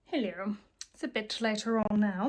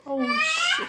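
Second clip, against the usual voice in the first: laughing oh no; 4 dB louder than usual. Real, not acted. Spill, laughs.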